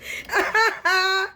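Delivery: a shrill-free, high cackle of glee; high gloating laugh. Real, not acted. A reader laughing at enemies who failed, gleeful cackle. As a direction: cackle over their failure.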